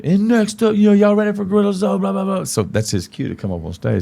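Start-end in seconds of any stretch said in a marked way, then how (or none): 0.0-2.4 high sustained emcee chant; DJ announcer. Retold or imitated. DJ calling up the next act, high announcer voice. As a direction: mimic a club DJ hyping the next act. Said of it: "listen for the D J to say".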